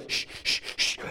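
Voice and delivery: soft unvoiced shh breathing sounds, in a steady rhythm; whispered shh breaths. Shown as a demonstration. Showing the class the breathing he learned in labor, whispered shh breaths. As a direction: push the air out in soft shushes. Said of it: "you gotta go".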